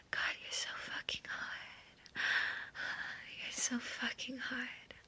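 soft, breath-only whisper; aroused whisper. Acted. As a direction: whisper it as an intimate aside. Intimate roleplay, aroused aside.

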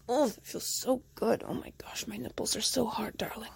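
ordinary talk

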